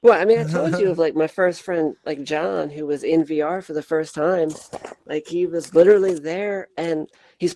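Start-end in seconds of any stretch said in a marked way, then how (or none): none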